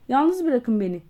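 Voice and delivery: a doctor yelling at his visitors, loud; shouting. Acted. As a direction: burst out, shouting to be left alone. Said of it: "diye bağırdı".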